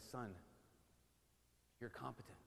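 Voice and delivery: low, soft, gentle blessing to a son; a father softly telling his son he has what it takes; tender fatherly blessing. Retold or imitated. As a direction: bless him like a proud father.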